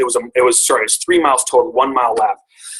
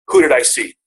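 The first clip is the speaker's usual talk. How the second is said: ordinary delivery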